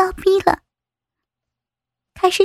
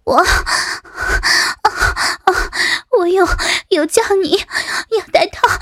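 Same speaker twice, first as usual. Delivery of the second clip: halting words amid moans; gasping protest; faster than usual. Acted. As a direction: blurt it out between heavy breaths. Breathless protest in acted scene.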